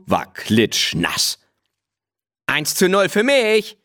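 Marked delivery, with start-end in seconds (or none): 2.5-3.8 high, gloating crow. A narrator's reading. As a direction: crow it like a cocky boy. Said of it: "krähte Willi".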